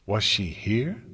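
pointed question with a sharp upturn; demanding. A narrator's reading. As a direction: demand an answer. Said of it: "Dash demanded".

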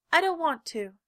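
stubborn refusal. A narrator's reading. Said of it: "he said again".

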